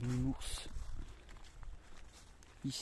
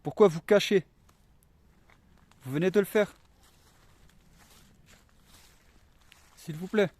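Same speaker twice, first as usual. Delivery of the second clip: calling out loud; 6 semitones higher, 10 dB louder than usual. Real, not acted. Calling out to spirits.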